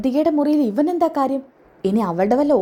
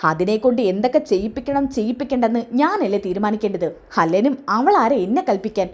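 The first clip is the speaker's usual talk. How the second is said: normal talking voice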